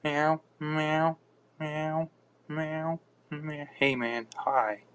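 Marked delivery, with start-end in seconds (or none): 0.0-3.7 high playful meows; cat meowing. Pretend. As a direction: meow like a kitten, pitched up. Imitating a cat.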